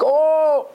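high, loud "oh!"; imitated cold-shock holler, pitch leaping up; shocked yell. Boy pushed into icy water, acted shocked yell. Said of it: "ההוא צעק".

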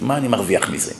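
a quiet, sunken rhetorical question; low muttered question. Shown as a demonstration. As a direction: drop low and soft, asking yourself.

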